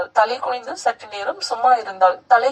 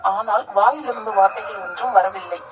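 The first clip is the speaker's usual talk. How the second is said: plain reading voice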